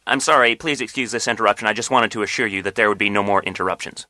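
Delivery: ordinary delivery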